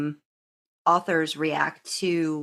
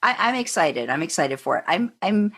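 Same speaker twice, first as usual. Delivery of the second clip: normal talking voice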